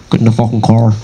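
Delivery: low Northern Irish impression; Northern Irish accent. Shown as a demonstration. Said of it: "the Northern Irish accent".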